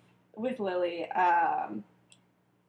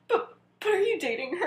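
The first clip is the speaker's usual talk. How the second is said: high-pitched mock disbelief, close to laughing; incredulous stammer; 9 semitones higher than usual. Acted stammered question to friend.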